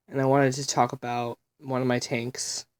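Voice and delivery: normal talking voice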